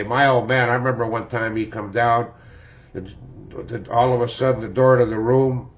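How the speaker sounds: usual speaking voice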